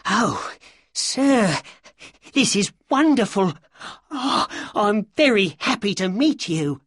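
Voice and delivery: very high, breathless-with-delight little man; excited. A narrator's reading. Little man delighted to meet Holmes, excitedly. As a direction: gush with excitement, voice pitched right up. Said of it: "said the little man excitedly".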